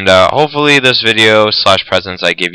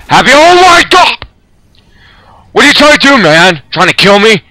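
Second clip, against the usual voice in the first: very high, loud alarmed cry; panicked shriek; 11 semitones higher, 4 dB louder than usual. Real, not acted. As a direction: yell in fright at near miss. Helicopter dives at him.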